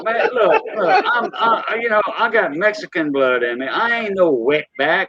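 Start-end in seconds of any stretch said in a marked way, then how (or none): 0.0-2.0 laughing. Real, not acted.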